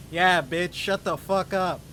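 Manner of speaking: loud joining-in mock yell; acted-out shouted insult; retold yelling. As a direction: pile on like the giggly accomplice kid. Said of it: "we're both just yelling".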